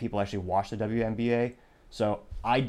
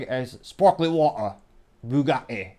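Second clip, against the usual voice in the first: Andrew Tate impression; 4 semitones higher, 6 dB louder, slower than usual. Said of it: "as Andrew Tate might say".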